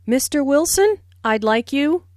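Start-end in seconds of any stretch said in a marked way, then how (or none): 0.1-1.0 Mister Wilson rising at the end; rising tune. Shown as a demonstration. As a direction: lift Wilson as you call him. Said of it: "Notice the rising intonation on Mister Wilson".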